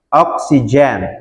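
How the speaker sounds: Oksigen said slowly with a clear j in gen; slow model. Shown as a demonstration. Teacher showing students the J in Oksigen. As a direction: lean slowly on the j sound in Oksigen. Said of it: "itu ada D sama J".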